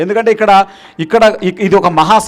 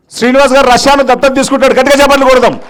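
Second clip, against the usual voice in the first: high, raised emcee voice; rousing call to the crowd; rallying announcement; 5 semitones higher, 4 dB louder than usual. Real, not acted. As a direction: proclaim it and call for big applause. Announcing volunteer, calling for applause.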